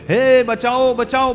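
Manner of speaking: high, forceful cry for rescue; calling for help. Acted. Drowning man shouts to be saved, calling for help. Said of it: "જોરથી".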